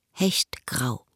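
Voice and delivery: Hechtgrau whispered low with a falling end; whispered echo. Acted. Woman repeats the suit colour to herself, whispered. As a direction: breathe Hechtgrau to yourself in a whisper.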